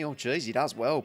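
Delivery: ordinary talk, nothing marked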